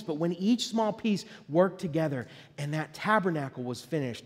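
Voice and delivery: ordinary delivery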